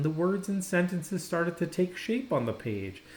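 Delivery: ordinary delivery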